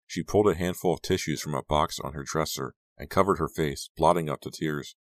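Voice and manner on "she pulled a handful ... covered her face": usual speaking voice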